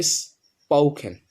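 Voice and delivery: slow স্পোকেন with plain p; correct spoken. Shown as a demonstration. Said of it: "সুতরাং". (ধীরে সঠিক উচ্চারণ স্পোকেন)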